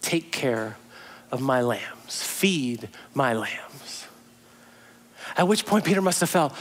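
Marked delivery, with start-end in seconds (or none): 0.0-4.0 soft, slow, tender reassurance; tender Jesus. Retold or imitated. Jesus gently restoring Peter, soft and slow. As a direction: gently settle him, slowly and softly. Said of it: "Jesus says".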